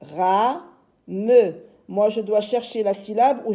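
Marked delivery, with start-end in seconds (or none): 0.0-1.5 "Ra, me" split in two; syllable by syllable. Shown as a demonstration. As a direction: hold "Ra", then drop a firm "me". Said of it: "J'ai deux syllabes".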